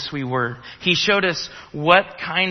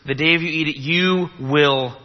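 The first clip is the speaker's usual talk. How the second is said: ordinary talk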